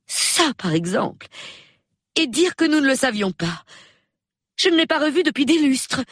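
high-pitched, excited amazement; amazed. A narrator's reading. Hostess hears old friend was nearby, amazed. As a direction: marvel at it with soaring pitch.

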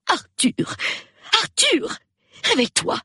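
high, insistent wife; urgent wake-up call. Acted. Wife wakes her sleeping husband, high and urgent. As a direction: call him awake in a high voice.

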